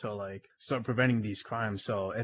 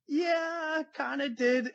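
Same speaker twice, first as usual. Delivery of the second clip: high, sheepish confession; caught-out admission to the captain, voice pitched up; 13 semitones higher, much slower than usual. Retold or imitated. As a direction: own up with a guilty squeak.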